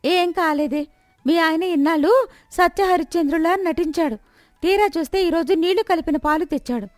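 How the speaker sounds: angry neighbour shouting accusations at the milkman's wife. Acted.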